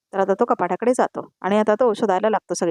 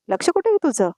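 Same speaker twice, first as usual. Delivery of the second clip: a high, snapped rebuke; mother-in-law scolding the daughter-in-law for dropping a plate, sharp and high; 8 semitones higher than usual. Acted. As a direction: scold her sharply, pitch jumping higher.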